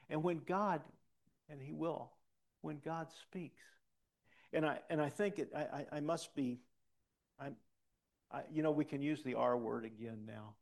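ordinary talk

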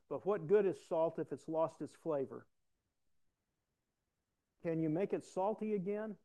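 loud, measured Bible reading to the congregation; scripture reading. Acted. Pastor reading verses aloud to the church, solemn.

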